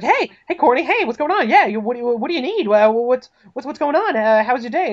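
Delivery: high, eager, flustered greeting; excited. Acted. Detective greets the woman he has a crush on, excited. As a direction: fall over yourself greeting a crush. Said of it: "he gets really excited".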